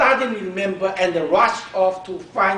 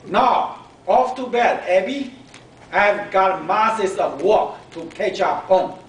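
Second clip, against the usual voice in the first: brisk mom; 4 semitones higher, 6 dB louder than usual. A narrator's reading. Mom sending Abby to bed.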